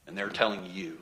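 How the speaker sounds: ordinary talk, nothing marked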